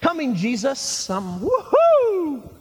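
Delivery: high, slow, whooping eagerness; hammed-up carefree whoop, drawn out; gung-ho Peter. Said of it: "I don't think Peter was like".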